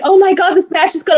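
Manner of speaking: high, gushing crowd voice; mock fan gushing. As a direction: imitate the excited fans around. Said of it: "they're like".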